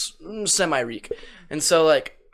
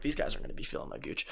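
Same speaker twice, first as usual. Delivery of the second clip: inner smug thought; 14 semitones lower, 16 dB quieter, faster than usual. Retold or imitated. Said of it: "I'm like".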